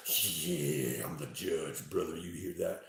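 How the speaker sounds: lightly voiced judge, low and soft; imagined judge. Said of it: "It's, it's Shalimar who's in timeout. He's like".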